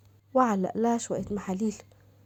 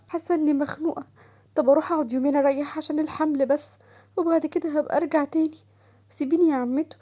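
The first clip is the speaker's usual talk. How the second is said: put-on higher, pleading voice of an upset young woman; hurt young wife; 5 semitones higher than usual. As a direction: beg the aunt, close to tears. Shams insists on leaving. (صوت زوجة شابة مجروحة تتوسل)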